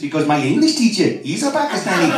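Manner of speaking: a boy in a hammed-up Pakistani English accent; punchline in a put-on Pakistani English accent; a boy explaining his teacher, in a mock Pakistani English accent. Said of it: "why are you talking like that".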